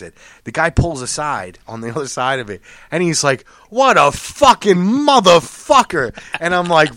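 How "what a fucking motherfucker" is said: higher, slower outraged voice; mock outraged driver. Other driver pulls alongside, outraged and slow. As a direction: spit it out slowly in a put-on voice.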